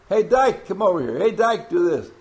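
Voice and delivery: high, loud mock call to a pal; imitating friends hailing him. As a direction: holler like a buddy across the yard. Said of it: "what do your friends call you".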